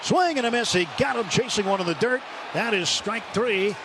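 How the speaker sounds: ordinary talk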